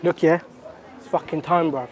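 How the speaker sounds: usual speaking voice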